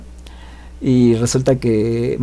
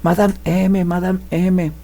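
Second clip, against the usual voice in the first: high distant call of a name; mock far-off calling; calling from afar; 7 semitones higher, slower than usual. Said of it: "a lo lejos se oye que le empiezan a hablar".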